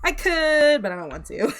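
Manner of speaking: high, loud mock consideration dropping to refusal; not having it. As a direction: tease with a playful, reluctant no. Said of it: "You guys are just not having it".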